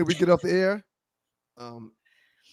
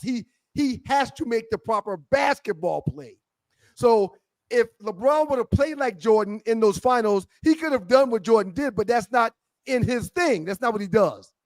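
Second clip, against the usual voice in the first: normal talking voice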